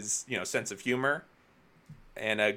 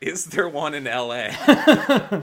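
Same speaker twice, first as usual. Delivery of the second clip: slow, loud question laughed through; asking while cracking up; laughing; 4 semitones higher, 9 dB louder, much slower than usual. Real, not acted. Host laughing at co-host's fuzzy Planet Hollywood memory, slow and loud.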